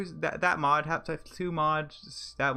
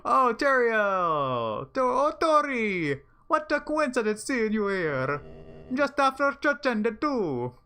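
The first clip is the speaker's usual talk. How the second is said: slow, drawn-out loud greeting; hammed-up Asgore; 5 semitones higher, 4 dB louder, slower than usual. Gamer voicing Asgore greeting Toriel, slow hammed-up sweetness. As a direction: ham up an overly sweet greeting. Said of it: "He doesn't speak like that".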